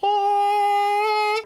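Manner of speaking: sustained loud, very high "ah"; high falsetto "ah". Retold or imitated. Reviewer mimics the rapper's falsetto, high held "ah". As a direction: imitate a singer reaching up into falsetto. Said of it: "he'll go up to that high".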